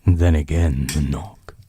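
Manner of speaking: hushed, unhurried bedtime reading; quiet storyteller building suspense; story narration. A narrator's reading. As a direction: read it like a book by the bedside.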